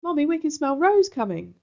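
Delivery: put-on high, loud excited kids; children in chorus. A narrator's reading. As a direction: call out together like eager little ones. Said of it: "all her brothers and sisters say together".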